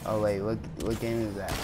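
ordinary talk

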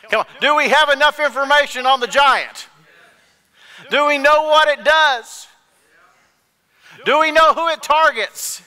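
high, shouted sermon questions; full-force preaching. Real, not acted. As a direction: drive it home to the congregation at the top of your voice.

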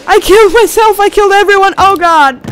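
normal talking voice

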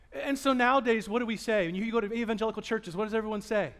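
ordinary talk

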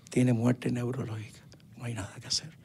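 hushed whisper, barely voiced; doctor whispering that the boy is brain dead. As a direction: breathe the bad news in a whisper. Doctor breaks news of death.

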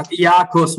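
"Iacos" said as YAH-kos; "Iacos" with a y-glide start, Italian style; Italian pronunciation. Shown as a demonstration. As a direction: say "Iacos" in two syllables, stressed YAH first. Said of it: "we pronounce it".

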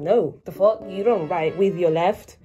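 ordinary talk

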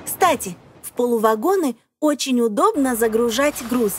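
ordinary talk

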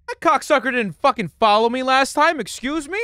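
high, loud protest; indignant outburst. Real, not acted. Player caught by a skeleton, indignant. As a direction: yell it in outraged disbelief. Outraged after the skeleton catches him.

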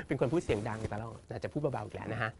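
speaking softly. Real, not acted. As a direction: keep the voice way down, half amused.

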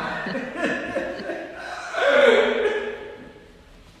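very high burst of laughter; loud laugh. Real, not acted. Priest delighted by the youths' chant, high loud laughing. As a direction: laugh hard after your punchline.

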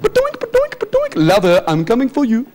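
high-pitched cartoon skunk lover; Pepe Le Pew. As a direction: bounce along, then croon like a cartoon Romeo. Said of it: "kind of like Pepe Le Pew".

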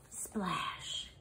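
whispered splash. A narrator's reading. Reading the tumble into the pool, soft whisper. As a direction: breathe the word in a whisper.

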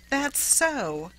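high, scared voice feigning ease; agree, trying to sound natural though frightened. A narrator's reading. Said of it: "scared voice which she tried to make natural".

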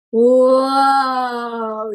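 long, drawn-out whoa of wonder; stretched, awed whoa; amazed whoa. Acted. Keiko lifted high on shoulders.

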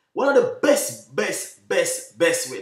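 high, loud and slow, hammering each best; excited emphasis. Acted. Chef hyping his curry tips to viewers, excited and loud. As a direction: sell it like the best thing ever.

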